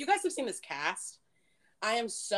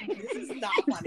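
quick, clipped scolding; lightly voiced annoyed mom; stern mom; 4 semitones higher, faster than usual. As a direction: scold the kids, fast and firm. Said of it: "And mom's like".

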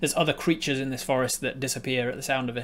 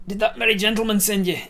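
higher, louder questioning voice; npc voice; 7 semitones higher, 6 dB louder than usual. Acted. GM's character challenges the sprite, higher and louder. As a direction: demand it of the stranger.

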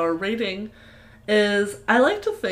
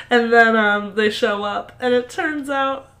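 ordinary delivery

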